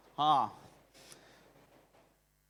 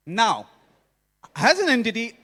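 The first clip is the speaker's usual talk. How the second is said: ordinary delivery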